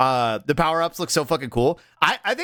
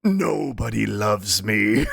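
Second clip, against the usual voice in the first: very slow, low mock lament; drawn-out gloomy imitation; imitated video character; 6 semitones lower, much slower than usual. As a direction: drawl it low and forlorn. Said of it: "and then it just".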